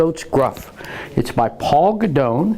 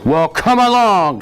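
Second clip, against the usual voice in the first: higher-pitched defiant invitation; loud big goat; 6 semitones higher, 3 dB louder than usual. A narrator's reading. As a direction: dare the troll to come on. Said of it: "voice was as loud as the troll's".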